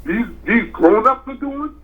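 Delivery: high-pitched imitation of a baffled kid; incredulous child. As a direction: mimic a child questioning the adults. Voicing the grandson judging adults.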